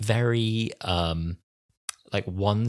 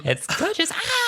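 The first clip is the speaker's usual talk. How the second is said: squeaky, high gushing echo; high squeal; slower than usual. Retold or imitated. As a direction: squeal along with delight. Echoing Donna's gushing line.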